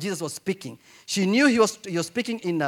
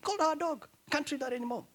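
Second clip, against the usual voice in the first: mock dismay; 8 semitones higher, 6 dB quieter, faster than usual. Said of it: "We're saying".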